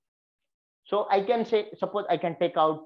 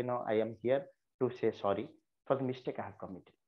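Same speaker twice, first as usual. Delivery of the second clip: softer, lower, humble; very apologetic; 6 semitones lower, 8 dB quieter, faster than usual. Shown as a demonstration. Pupil apologises sincerely to the principal, soft. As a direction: own the mistake gently. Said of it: "very apologetic".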